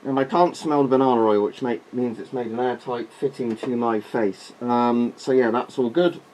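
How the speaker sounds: muffled behind a rubber mask; through a gas mask. Real, not acted. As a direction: talk through the mask's voice diaphragm. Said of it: "voice diaphragm hopefully looks quite good".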